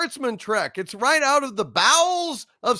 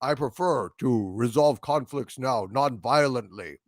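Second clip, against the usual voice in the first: mock Worf; 9 semitones lower than usual. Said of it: "Worf says".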